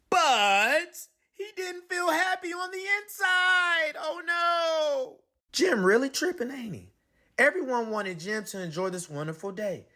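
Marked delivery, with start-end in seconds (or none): none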